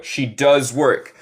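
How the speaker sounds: slow, careful she does work with a clear English does; correct pronunciation. Shown as a demonstration. Teacher to students: slow, careful correct she does work. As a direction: model the right way, slowly. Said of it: "aquí tenemos lo correcto".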